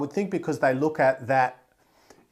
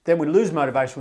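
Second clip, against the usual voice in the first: ordinary delivery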